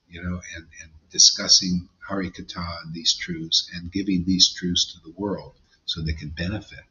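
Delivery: ordinary talk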